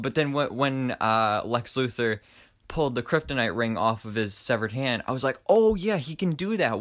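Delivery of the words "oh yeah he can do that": higher, louder burst of realization; retold excited "oh yeah". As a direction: act out suddenly remembering, pitched up. Acts out sudden hopeful realization.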